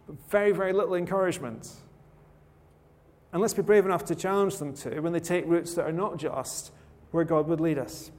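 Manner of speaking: ordinary talk, nothing marked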